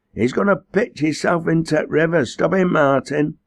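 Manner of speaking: high, loud yell for help; alarmed yell. A narrator's reading. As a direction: yell an urgent warning, pitched right up. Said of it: "yelled Teddy Tripp".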